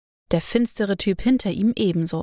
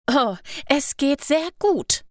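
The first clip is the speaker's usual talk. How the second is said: hissing back; 6 semitones higher, slower than usual. A narrator's reading. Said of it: "fauchte sie zurück".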